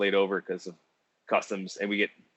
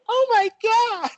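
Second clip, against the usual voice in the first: very high, slow shocked gasp; shocked women; 7 dB louder, much slower than usual. Retold or imitated.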